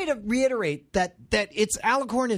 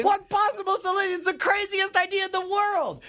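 high-pitched indignant disbelief; scandalized, high retort; incredulous outburst; 10 semitones higher than usual. Real, not acted. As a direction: throw it back in disbelief, voice jumping up. Heated mock argument, incredulous retort.

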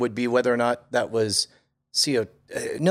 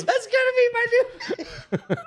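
high, slow, laughing squeal; slower than usual. Real, not acted. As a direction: squeak out the words while laughing.

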